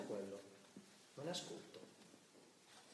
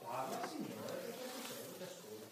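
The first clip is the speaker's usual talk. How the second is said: "Parla, Signore, perché il tuo servo ti ascolta" whispered softly; preacher showing a whispered, listening prayer; hushed prayer; 4 semitones higher, 7 dB quieter than usual. Shown as a demonstration. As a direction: breathe the words as a private prayer. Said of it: "E dopo?".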